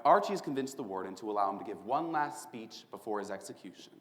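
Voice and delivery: normal talking voice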